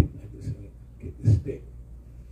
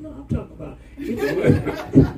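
laughing son; 12 semitones higher, 4 dB louder than usual. Retold or imitated.

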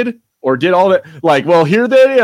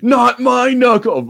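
high, slow mock-whine in a put-on Cockney-free fan voice; hammed-up offended fan, drawn out; whiny fan; 9 semitones higher, slower than usual. Mocking upset fans of the film with a slow high whine. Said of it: "someone somewhere would be like".

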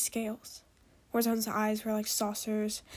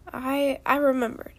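usual speaking voice

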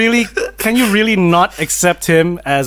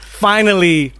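ordinary talk, nothing marked

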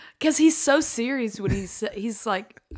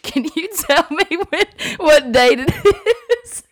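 laughing; 6 semitones higher, 4 dB louder than usual. Retold or imitated. Laughing through quoted request.

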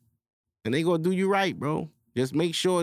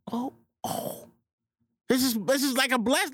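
a whispered oh, then high amazed joy; hushed delight; 12 semitones higher than usual. Acted. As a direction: marvel at the news like a blessing. Acting out delighted discovery.

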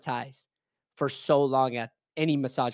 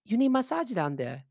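higher, mock Chinese accent; imitating Chinese-accented old lady; 7 semitones higher than usual. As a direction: offer it in a put-on Chinese accent. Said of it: "she's like".

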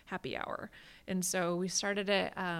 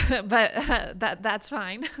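laughing; 4 semitones higher, 11 dB louder, slower than usual. Real, not acted. Laughs about not liking cars.

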